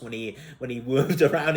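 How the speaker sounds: ordinary delivery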